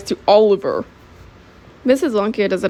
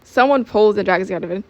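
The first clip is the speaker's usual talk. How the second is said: usual speaking voice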